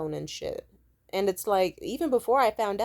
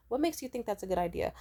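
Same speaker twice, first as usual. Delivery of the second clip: ordinary talk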